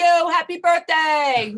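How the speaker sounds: slow, tender happy birthday; warm birthday wish. Real, not acted. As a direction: stretch it out lovingly.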